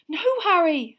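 high, loud cry of fright; petrified gasp. A narrator's reading. Hermione holding Harry back, petrified. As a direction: cry out to stop him, terrified. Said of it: "gasped in a petrified whisper".

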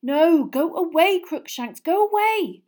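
high, loud, pleading; desperate plea. Acted. Hermione pleading with her cat to go away. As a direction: beg the cat to leave, desperate. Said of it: "Hermione moaned".

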